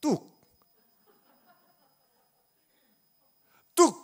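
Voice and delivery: blunt Gyeongsang-style 뚝, clipped and firm; one-word Gyeongsang-dialect command; curt Gyeongsang command. Retold or imitated. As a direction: bark 뚝 like a Gyeongsang elder. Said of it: "경상도식으로 말하면".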